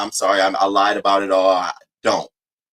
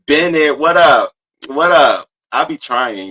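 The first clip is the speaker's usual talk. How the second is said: usual speaking voice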